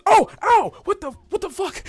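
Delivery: high, startled yelp, loud at first; pained, bewildered guard voice. Acted. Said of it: "one of the guards goes".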